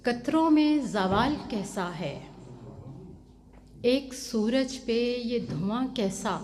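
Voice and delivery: measured, lilting mushaira delivery; ghazal recitation. Acted. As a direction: recite each line as Urdu verse, with held pauses.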